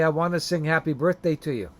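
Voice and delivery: ordinary delivery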